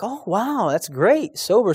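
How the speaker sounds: high, amazed oh wow; surprised delight. Retold or imitated. Pastor reacting to the lady's news with high surprised delight. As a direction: pastor hears good news, lightly surprised. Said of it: "And he was like".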